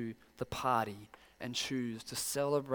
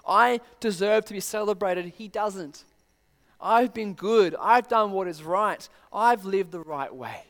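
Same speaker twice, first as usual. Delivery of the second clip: indignant elder son; 5 semitones higher, 7 dB louder than usual. Retold or imitated. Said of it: "what he's trying to say is that".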